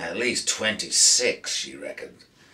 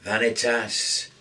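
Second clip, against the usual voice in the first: somber. A narrator's reading. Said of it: "Jake said somberly".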